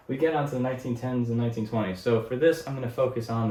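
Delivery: rapid wired talking; too much caffeine. Real, not acted. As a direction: race through the line, amped up. Wired teacher rushing on.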